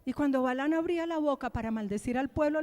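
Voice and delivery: ordinary talk, nothing marked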